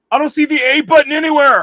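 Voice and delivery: loud, high, confused mock gamer yelling for the button; mocking clueless gamer. Vlogger mocking shooter-game kids, loud high mock panic. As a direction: ham up a panicky kid who can't find the button. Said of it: "pick up a gun and go".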